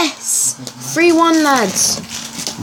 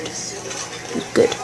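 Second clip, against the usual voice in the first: soft whispered repeat; 9 dB quieter, slower than usual. Acted. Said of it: "No, I'm joking".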